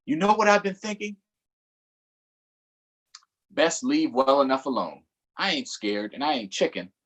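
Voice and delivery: ordinary delivery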